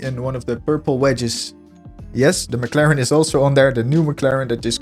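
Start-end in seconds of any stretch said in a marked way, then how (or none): none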